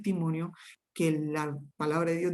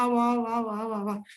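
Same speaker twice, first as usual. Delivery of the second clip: imitated tongues babbling; 3 semitones higher, faster than usual. Preacher showing how words flowed from him, imitated babbling. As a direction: re-enact looping, wordless-sounding babble. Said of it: "Y solamente decía".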